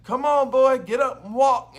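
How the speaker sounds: loud, high mock yell of a pushy parent; impatient parent yelling. Parent yelling at toddler to walk, loud impatient mock yell. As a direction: bark it at a toddler who won't walk. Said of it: "Mom and Dad weren't like".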